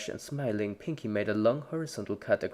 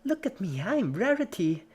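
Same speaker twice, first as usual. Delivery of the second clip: high, hammed-up diva voice; mock Rarity; 10 semitones higher, 5 dB louder than usual. A narrator's reading. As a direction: play dress-up as Rarity. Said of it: "Pinky laughed".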